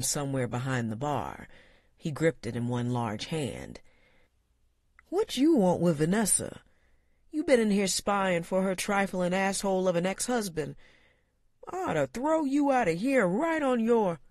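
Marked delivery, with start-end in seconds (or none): 5.1-14.2 high, rising menacing bartender; suspicious threatening uncle. A narrator's reading. Uncle suspecting a spy, rising menace. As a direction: threaten the stranger with a bat.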